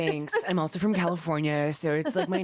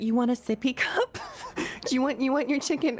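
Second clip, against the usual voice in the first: put-on high, sing-song toddler talk; 8 semitones higher than usual. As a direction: coo it like to a small child. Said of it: "You're like".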